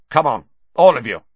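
loud fast command. A narrator's reading. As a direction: bark the order. Snape commanding, deranged.

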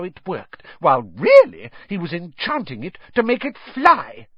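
high, indignant scolding; angry wife. A narrator's reading. Wife accusing her husband over the car, high angry scolding. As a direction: lay out the accusation in rising outrage. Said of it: "her eyes flashing".

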